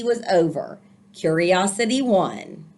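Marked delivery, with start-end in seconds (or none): none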